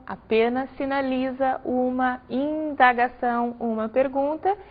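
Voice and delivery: ordinary talk, nothing marked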